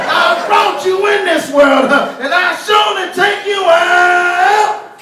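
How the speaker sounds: high, loud, slow hellfire preaching shout; roaring brimstone sermon with long drawn words; brimstone preacher grandmother. Retold or imitated. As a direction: preach it like a furious grandmother at the pulpit. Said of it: "went off on him like a brimstone preacher".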